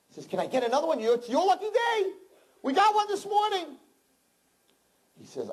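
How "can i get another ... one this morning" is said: squeaky shopkeeper. Acted. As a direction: squeak it out like a jolly merchant. Shopkeeper answers peasant.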